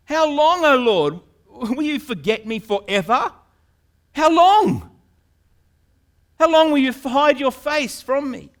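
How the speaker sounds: loud, high, accusing cry to God; brazen protest. Acted. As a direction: hurl the question at God. David brazenly questioning God.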